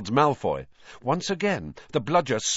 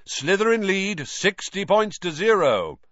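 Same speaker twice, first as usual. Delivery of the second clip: higher, announcer-style score call; commentator call; 9 semitones higher than usual. A narrator's reading. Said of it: "Lee Jordan, who was commentating".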